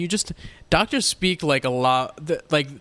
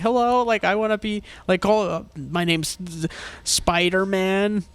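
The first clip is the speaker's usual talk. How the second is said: higher-pitched imitation of a child; kid at counter; 6 semitones higher than usual. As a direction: play an eager kid giving a made-up name. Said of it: "If the kid is".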